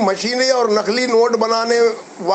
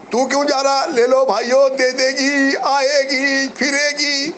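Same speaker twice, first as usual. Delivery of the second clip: high, teasing voice, like a huckster hawking promises; mocking sing-song; 6 semitones higher than usual. Mocking the promoter's promise to investors, high teasing sing-song. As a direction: tease the investors' false hope, pitched up.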